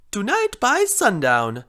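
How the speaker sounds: put-on loud, swooping Italian accent; emphatic mock Italian accent. A narrator's reading. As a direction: announce it with a sing-song Italian accent. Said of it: "sounded like an Italian accent".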